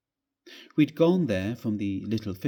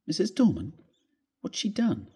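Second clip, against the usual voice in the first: relieved inquiry; 7 semitones higher than usual. Acted. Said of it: "I inquired, immensely relieved".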